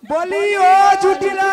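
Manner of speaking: loud, drawn-out call from outside. Acted. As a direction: raise your voice and stretch the name out. Visitor calls out for the household. (গলা ছেড়ে টেনে টেনে ডাক)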